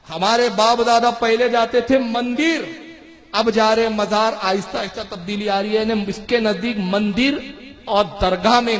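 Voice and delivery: normal talking voice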